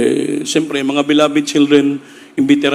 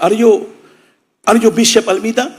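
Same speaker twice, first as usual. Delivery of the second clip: American visitor asking; 5 semitones higher, 3 dB louder than usual. Retold or imitated. American stranger asks at the door.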